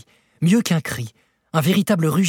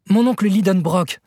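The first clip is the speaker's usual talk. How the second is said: calling louder; 6 semitones higher, 4 dB louder than usual. A narrator's reading. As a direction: raise the voice, higher and louder. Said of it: "répétai-je en élevant la voix". (en élevant la voix)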